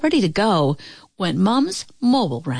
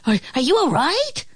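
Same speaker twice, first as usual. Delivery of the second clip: high, hesitant piglet question; little pigs; 8 semitones higher than usual. A narrator's reading. Pigs check on the fallen wolf, high hesitant voice. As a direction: ask it high, with a small stammer. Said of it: "The three little pigs asked".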